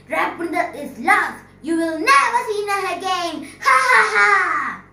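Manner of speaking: high, loud cackling witch; evil witch. Acted. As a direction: gloat like a wicked witch. Witch gloats at the prince.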